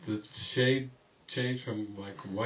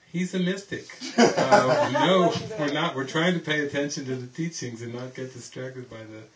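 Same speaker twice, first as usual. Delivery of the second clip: mock devotee; 3 semitones higher, 7 dB louder than usual. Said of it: "the other half were going".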